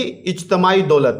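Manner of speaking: usual speaking voice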